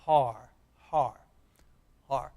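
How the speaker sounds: Har said slowly with a long open ah; sounding out. Shown as a demonstration. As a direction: model Har for students to repeat.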